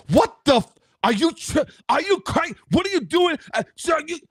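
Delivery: high-pitched, indignant yelling at another driver; irate driver losing it; outraged confrontation. Acted. Imagined furious driver confronting another.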